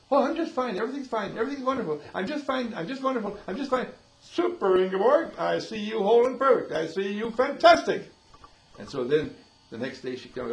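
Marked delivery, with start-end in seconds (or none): 0.1-3.9 high, insistent brush-off as an acted patient; mock-cheerful denial, much higher than usual; breezy reassurance. 4.2-8.1 raised, loud declaration of approval; emphatic, high and loud congratulation; hearty affirmation. Acted. Acted hearty affirmation to the patient.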